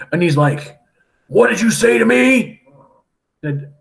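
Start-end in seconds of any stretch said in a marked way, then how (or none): 1.3-2.6 indignant pirate challenge. Retold or imitated. Pirate confronts the doctor.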